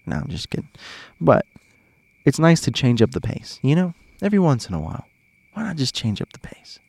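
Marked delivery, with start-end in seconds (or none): 0.1-0.7 breathy whispered take-back; a quick hushed "just kidding"; whispered aside. Real, not acted. 5.5-6.8 soft unvoiced whisper; hushed playful question; whispered aside. Real, not acted.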